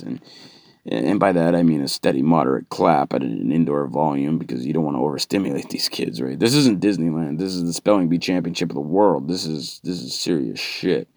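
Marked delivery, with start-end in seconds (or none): none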